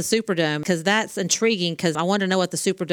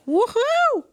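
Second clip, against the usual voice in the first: excited whoop. Retold or imitated. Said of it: "the speech therapist in me is like".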